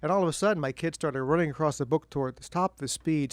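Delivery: ordinary talk, nothing marked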